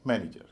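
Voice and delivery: manager joined up as MAN-ij-er; stress on first syllable. Shown as a demonstration. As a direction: manager with heavy first syllable, the rest light. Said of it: "उच्चारण होगा".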